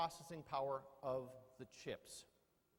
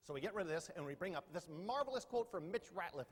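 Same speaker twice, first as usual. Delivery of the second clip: normal talking voice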